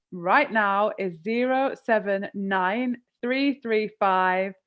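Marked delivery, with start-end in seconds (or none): 1.2-4.5 slow, each digit spoken clearly; slowly. Shown as a demonstration. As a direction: dictate the number slowly so viewers can note it. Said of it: "I'm gonna say it slowly".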